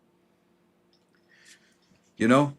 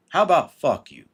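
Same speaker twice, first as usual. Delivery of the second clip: a louder, cheeky retort; defiant retort; 4 semitones higher, 7 dB louder than usual. Acted. Mocking the advice with a cheeky retort. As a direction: toss it back at the advice-giver. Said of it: "Yeah, I said it".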